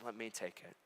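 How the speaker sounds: soft, low offer to take the blame; quiet offer. Acted. Hero to the judge, soft and quiet. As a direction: give yourself up gently.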